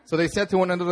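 usual speaking voice

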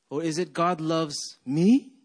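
"me" punched with a rising question; stress on ME. Shown as a demonstration. Pastor asking congregation their point of emphasis, stress on "me". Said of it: "what the point of emphasis".

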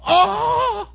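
very high, loud squeal; big mock squeal of joy; excited child's gasp. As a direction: imitate a kid shrieking with delight. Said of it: "He gets so excited".

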